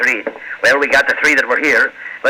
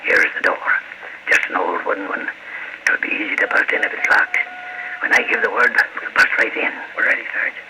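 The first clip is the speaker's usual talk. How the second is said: hushed orders, mostly breath; whispering; 6 semitones lower, 7 dB quieter than usual. Acted. Sergeant to his men outside the door, whispering. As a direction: whisper so the crooks inside won't hear. Police sneaking up to warehouse door.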